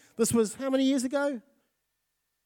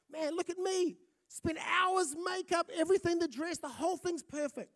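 higher voice, showing off the dress; proud bride; 6 semitones higher, 6 dB quieter than usual. Retold or imitated. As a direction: play the bride pleased with her look. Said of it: "She's ready".

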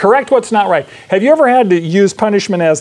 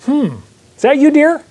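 mock innocent spouse; 8 semitones higher than usual. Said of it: "we go".

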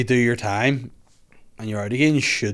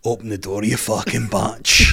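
drawn-out mock South African insult; laughing, put-on South African accent; 2 semitones lower than usual. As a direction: imitate a South African, stretching the last words. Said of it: "South African".

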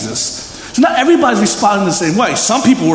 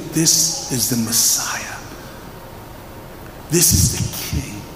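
hushed confession; 7 semitones lower, 8 dB quieter, much slower than usual. Acted. Solemn confession in sermon.

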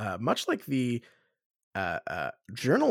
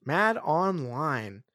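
slow, drawn out, Mad stressed; slow drawn-out title; much slower than usual. Shown as a demonstration. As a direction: stretch out the words Mad Online slowly. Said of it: "repeating the title slowly".

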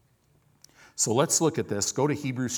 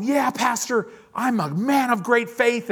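mock pious churchgoer; 11 semitones higher, 5 dB louder than usual. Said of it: "just going".

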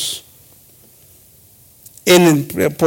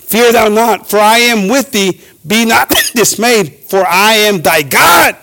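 slow, declaimed Bible verse; stately, drawn-out scripture reading; scripture proclamation; 3 semitones higher, slower than usual. Acted. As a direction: proclaim it deliberately from the pulpit. Reads scripture aloud.

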